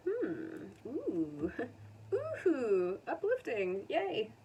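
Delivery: high, bright squeals of delight; thrilled by an uplifting card; delighted oohs and laughs. Real, not acted. Tarot reader cheering an uplifting card, high delighted squeals.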